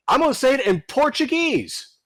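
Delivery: high whiny mock voice; mocking whiny user. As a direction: mimic a pestering user. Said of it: "mocking the user".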